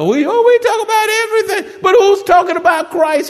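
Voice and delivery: high, loud preacher's cry; preaching at full force. Acted. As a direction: lift your voice and press the question.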